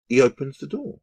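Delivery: ordinary talk, nothing marked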